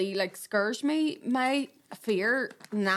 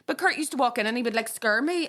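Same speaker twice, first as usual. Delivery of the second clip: normal talking voice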